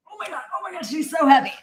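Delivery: straining. Real, not acted. Lifting the heavy dog.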